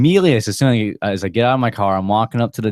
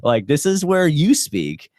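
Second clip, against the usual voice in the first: higher, pointed instruction; mock bossy order; 8 semitones higher than usual. Man retelling ordering the silent sheriff, bossy. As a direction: tell the cop firmly where to talk.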